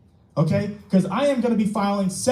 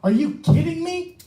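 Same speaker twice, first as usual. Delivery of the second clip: exasperated outburst; 9 semitones higher than usual. Real, not acted. Citizen at council meeting, outraged at police, exasperated outburst. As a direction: pitch jumps way up, disbelieving and fed up. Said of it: "I'm actually pretty damn frustrated".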